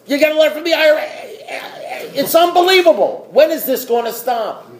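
high-pitched, mounting disbelief; worked-up, incredulous high-pitched tirade; exasperated rant. Acted. Acting out overwhelmed outrage at life.

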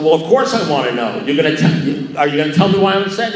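loud, high, impatient questioning; exasperated demand. Retold or imitated. Caller pressing his cagey sponsor, loudly impatient. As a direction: demand the answer, rushing at the end.

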